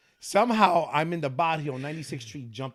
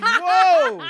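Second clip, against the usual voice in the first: mock surprise; 11 dB louder than usual. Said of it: "So I'm like".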